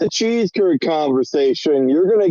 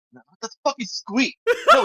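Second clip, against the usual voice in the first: normal talking voice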